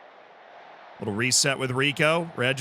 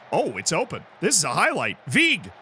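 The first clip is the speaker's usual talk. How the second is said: normal talking voice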